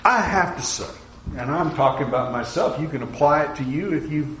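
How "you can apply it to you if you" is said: normal talking voice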